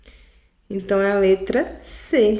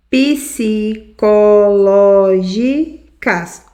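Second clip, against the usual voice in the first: Psicológicas drawn out very slowly and louder, stress on ló; slow syllable demo; 5 dB louder, much slower than usual. Shown as a demonstration. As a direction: stretch Psicológicas to count back to the third syllable. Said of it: "Uma, duas, três". (Psicológicas bem devagar e mais alto, marcando ló)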